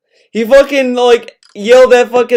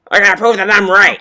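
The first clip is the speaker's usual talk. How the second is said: put-on quick southern-accented parroting; imitated southern accent; faster than usual. Listener parrots the streamer's "I gotta prove", mock southern accent. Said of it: "like, a southern accent?".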